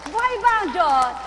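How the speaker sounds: 1 calling out. Real, not acted. Call to congregation.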